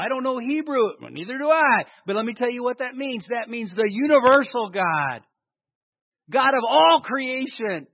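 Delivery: rising pulpit fervor about the universal God; preaching at full force. Acted. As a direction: build the proclamation, hammering each phrase. Preaching climax on God's name.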